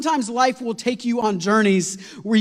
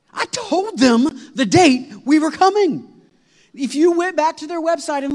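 exasperated; 7 semitones higher, 4 dB louder, slower than usual. Real, not acted. Exasperated retelling.

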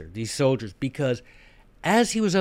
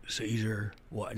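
trying out another odd interviewer voice, much softer; new reporter voice; 4 semitones lower, 12 dB quieter than usual. Pretend. Trying a new voice to ask Caesar, softer. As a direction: fumble for a fresh voice, half-joking. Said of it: "I have to come up with a better voice".

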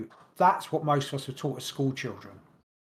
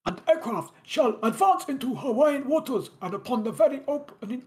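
much higher, stiff mock German accent; robotic hammed-up German accent, pitched way up; 8 semitones higher than usual. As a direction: bark the naval order like a cartoon German officer. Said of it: "I'm doing a German accent for Japan".

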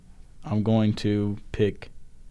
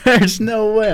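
laughing disbelief; 12 semitones higher, 7 dB louder, much slower than usual. Real, not acted. Laughing in disbelief at wrong answer.

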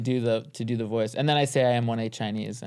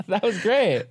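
high-pitched, laughing through the words; amused, barely out of a laughing fit; 10 semitones higher, slower than usual. Real, not acted. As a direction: say it while cracking up. Laughing after joke bombed.

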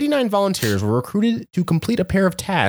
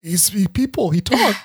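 flustered participant; 8 semitones higher than usual. Retold or imitated. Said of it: "people were like".

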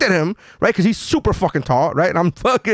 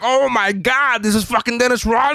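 starstruck fanboy; 6 semitones higher than usual. Retold or imitated. Starstruck fan gushing on meeting his hero. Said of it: "I was fanboying out".

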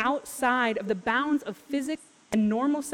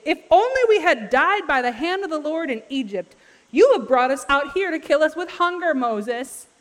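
loud, higher, melodramatic mock wail; freaking out; 5 semitones higher, 6 dB louder than usual. Hungry Israelites blaming Moses, mock freak-out. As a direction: lament it loudly like an overdramatic accusation. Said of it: "so they freak out again".

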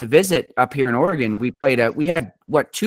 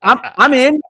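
excited; 8 semitones higher, 4 dB louder than usual. Retold or imitated. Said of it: "I was like".

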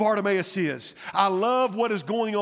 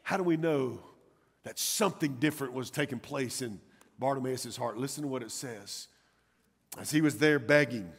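low, soft sermon aside; subdued, suspenseful pulpit storytelling; hushed preaching; 8 semitones lower, 6 dB quieter than usual. Acted. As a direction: draw the congregation in quietly.

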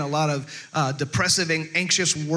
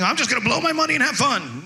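higher, giddy spender voice; mock carefree; 5 semitones higher than usual. Pastor mimicking a willy-nilly spender, higher and mock carefree. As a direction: toss it off high and reckless, hammed up. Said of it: "be carefree and willy-nilly".

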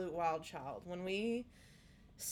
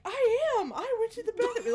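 put-on much higher and louder, a sobbing little girl; crying child; 14 semitones higher, 9 dB louder than usual. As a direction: wail it like a six-year-old in tears. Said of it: "Like, she's crying".

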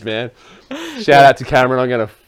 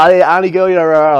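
higher, louder mock heckle; retelling the comedian's auntie joke, higher and louder; mock Benny loud; 7 semitones higher, 6 dB louder, faster than usual. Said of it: "she's like".

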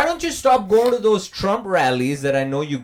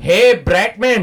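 put-on slow, loud Indian accent; 5 semitones higher, 7 dB louder, slower than usual. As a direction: drag out the name in an Indian accent. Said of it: "Indian Mister Freeze".

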